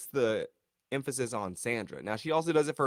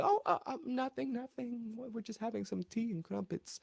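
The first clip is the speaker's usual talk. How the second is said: much higher, softer, caught-out stammer; mock nervous cover-up; 13 semitones higher, 7 dB quieter than usual. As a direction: squeak it like you got caught gossiping. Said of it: "And they're like".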